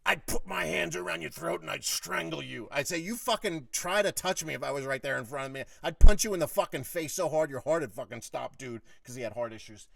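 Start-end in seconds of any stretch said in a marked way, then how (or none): 0.1-2.7 loud, raised-pitch imitation of a threat; heated mock menace; retold angry threat. Boss threatens him in retold row. 3.0-8.8 loud, forceful counter-threat; heated, punchy tirade, retold; retold letting him have it. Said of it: "I was really fucking letting him have it".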